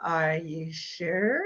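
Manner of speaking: slow, drawn-out questioning with a rise; sceptical probing. Acted. Coach challenges a leery client's answer, slow and sceptical. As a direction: press gently, stretching SURE upward. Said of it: "then I go through".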